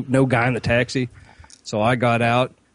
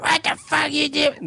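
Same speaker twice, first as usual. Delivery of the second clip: high, drawn-out mock drunk squeal; hammered woman; slower than usual. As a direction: imitate a drunk woman screeching, slowly. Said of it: "They were hammered".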